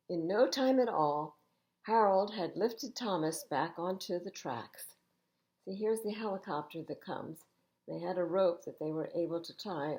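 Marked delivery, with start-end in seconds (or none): none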